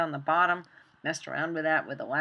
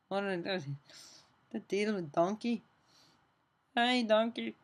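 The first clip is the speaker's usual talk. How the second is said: put-on a rough, playful Scottish accent, pitched a bit higher; lightly done Scottish impression calling to a donkey; 4 semitones higher than usual. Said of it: "I have to work on my Scottish accent there".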